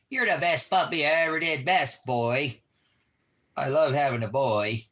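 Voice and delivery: high, loud doting voice for the puppy; baby talk to dog. Acted. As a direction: coo over your best boy, loud and high. Praises the puppy.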